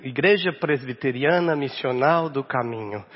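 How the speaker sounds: Igreja Presbiteriana Missional do Caminho in native Brazilian Portuguese; Portuguese name. Shown as a demonstration. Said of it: "That's the way we say in Portuguese".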